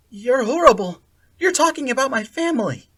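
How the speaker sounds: high, loud angry outburst. A narrator's reading. Akira accusing Sally Ann, loud and angry. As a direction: lash out at her.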